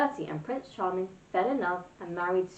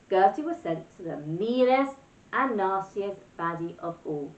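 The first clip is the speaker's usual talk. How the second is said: usual speaking voice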